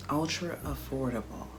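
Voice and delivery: ordinary talk, nothing marked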